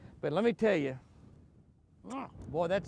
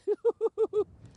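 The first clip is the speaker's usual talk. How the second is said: laugh; 5 dB louder than usual. Real, not acted. Delighted at big fish.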